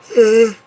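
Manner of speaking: big frustrated sigh, pitched high; loud fed-up exhale; loud exasperated sigh. Real, not acted. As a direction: let out a heavy sigh of exasperation. Frustrated about oversimplification.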